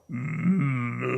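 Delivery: long, low soft moo; cow mooing. Acted. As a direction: moo like a cow across the fence. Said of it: "they look up and they go".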